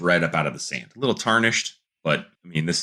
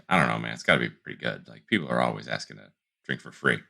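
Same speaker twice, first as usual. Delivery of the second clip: a hint of a low, doubtful bartender; lightly voiced skeptical bartender; a low-voiced bartender, just barely played; 7 semitones lower, 6 dB quieter, faster than usual.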